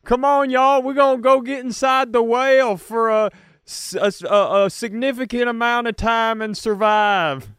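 high, loud barker call; hammed-up ride host. Host calls visitors into the whale ride, high and loud. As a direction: hype the crowd like a carnival host.

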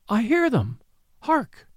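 high, eager call of hark; excited cry. A narrator's reading. Woman hearing the revellers, calling out high. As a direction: lift the voice as if you hear distant music. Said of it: "she cried".

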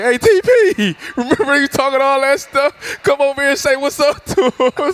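high-pitched, laughing call to a teammate; laughing mock shout to the rival coach; teasing call-out. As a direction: call him over, cracking up as you tease. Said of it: "And Vine turned around like".

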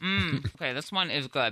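talking with a mouth full. Real, not acted. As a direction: chew and talk through a mouthful. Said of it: "I'm talking with my mouth full".

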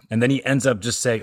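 ordinary talk

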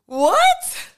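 a high, loud squeal of disbelief; overjoyed, incredulous "What?"; stunned delight. Real, not acted. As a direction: shriek it like you can't believe it. Overjoyed at answered prayers.

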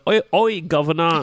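high, hammed-up Cockney British caricature; mock an American botching a British accent; bad British accent. Friend mimics Americans attempting British speech, cartoonish British accent. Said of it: "American people do not do a good British accent".

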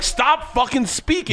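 very high, drawn-out retold plea; slow, high-pitched mock exasperation; exasperated outburst. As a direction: act out a frantic demand for silence. Retold exasperated outburst during sex.

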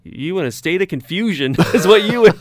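high, loud Southern impression, laughing; Southern accent. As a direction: imitate a Southern tollbooth woman, amused. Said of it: "with her, you know, her southern accent".

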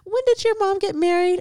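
imitated great aunt. Great aunt asks about mom's wedding, imitated in high voice. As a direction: put on a high-pitched relative's questioning voice. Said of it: "my great aunt, who was like my grandma".

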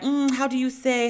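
lightly mocking a fake Spanish accent, searching for words. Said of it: "she has a whole accent and will be like".

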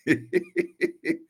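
high little chuckle; short string of high laughs. Real, not acted. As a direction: giggle at your own story. Amused by his own story.

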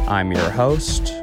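ordinary talk

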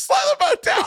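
imitated high-pitched furious yell; furious Bobby. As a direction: yell it at the boat driver, hammed-up rage. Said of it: "fucking furious".

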